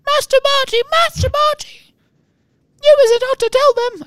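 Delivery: very high squeaky house-elf. Shown as a demonstration. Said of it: "I should be squeaking more".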